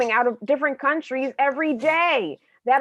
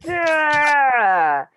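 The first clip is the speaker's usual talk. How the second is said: loud villain voice; 5 semitones higher, 4 dB louder than usual. Acted. New villain character arrives.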